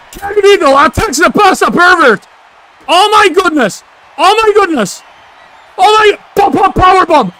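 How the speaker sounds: high, loud play-by-play shock; excited outcry. Real, not acted. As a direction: yell it like a stunned commentator. Excited ringside call of betrayal.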